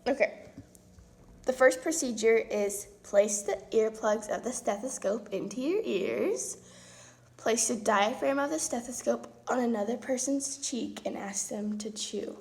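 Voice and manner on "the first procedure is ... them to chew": reading aloud. Acted. Reads lab instructions aloud.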